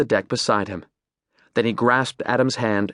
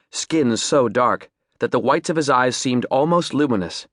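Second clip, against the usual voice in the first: ordinary delivery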